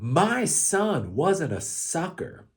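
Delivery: imitating a president shouting, but only half-hearted; mild mock yell. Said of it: "he started screaming about".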